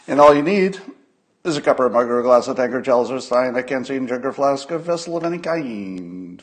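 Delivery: building, grand ceremonial announcement; ritual recital. Acted.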